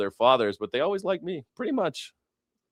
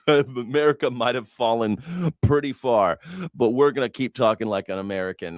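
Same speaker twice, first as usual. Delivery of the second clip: ordinary talk, nothing marked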